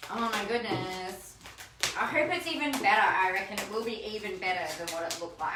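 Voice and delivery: normal talking voice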